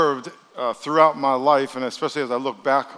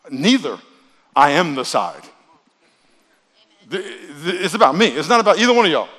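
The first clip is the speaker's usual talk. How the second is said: louder, commanding, laughing at the end; commanding, half-laughing angel; 3 semitones higher, 6 dB louder than usual. Retold or imitated. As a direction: declare it, then laugh. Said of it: "He says".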